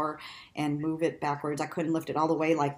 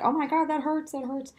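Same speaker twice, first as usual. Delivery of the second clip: high mock cry of pain; pained yelp; 11 semitones higher than usual. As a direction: yelp it like the arm was just yanked. Retold pain when arm grabbed.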